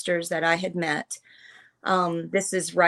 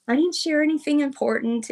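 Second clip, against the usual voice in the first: retold modest protest; 8 semitones higher than usual. Acting out modest protest to friend.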